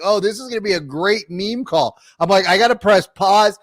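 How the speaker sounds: retold excitement. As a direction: relive the anticipation of a juicy call. Retells his gleeful reaction to call.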